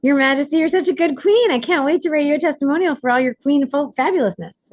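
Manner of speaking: high, loud gushing acceptance; hammed-up eager fawning; gushing yes. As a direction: play an over-the-top delighted yes to royalty. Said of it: "first say yes. First be like".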